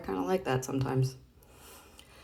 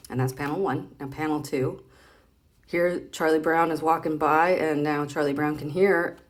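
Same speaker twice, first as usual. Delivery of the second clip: ordinary talk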